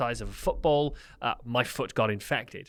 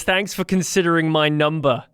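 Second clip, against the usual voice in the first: a put-on American accent, louder; 4 semitones higher, 6 dB louder than usual. Caller phones his dad back in a put-on American accent. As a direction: play it as an American Uber driver. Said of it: "with your American accent".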